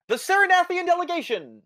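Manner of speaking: pompous fussy courtier. Acted. Herald announces delegation, redo.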